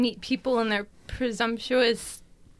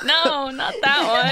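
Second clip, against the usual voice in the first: laughing; 5 dB louder than usual. Real, not acted. Laughing while correcting.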